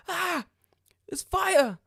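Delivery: high, sheepish neighbour voice; mock neighbour. The neighbour calmly telling him there's fire, high mock voice.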